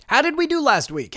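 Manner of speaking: lightly voiced fan asking; mock listener question. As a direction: ask it as the curious listener. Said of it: "I can hear your question".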